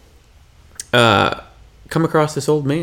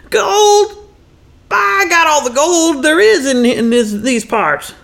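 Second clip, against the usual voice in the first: very high prospector voice, slowing at the end; old Texan man; slower than usual. Acted. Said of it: "he grew up in Texas".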